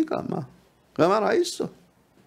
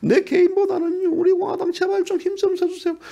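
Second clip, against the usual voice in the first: high, tearful mock plea; 높은 목소리로 울먹이며 애원하는 흉내; 눈물 흘리는 지지자 흉내; 13 semitones higher than usual. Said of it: "눈물 흘리는 분들". (대통령님께 도와달라고 높게 울먹이며 애원하는 지지자 흉내)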